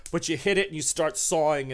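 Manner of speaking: usual speaking voice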